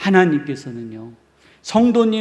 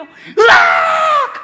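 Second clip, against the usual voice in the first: put-on a sudden loud, high child's wail; wailing cry; 10 dB louder, much slower than usual. Preacher imitates the child bursting into louder crying, loud wail. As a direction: let out a big bawling waah. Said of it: "하고 우는 거예요".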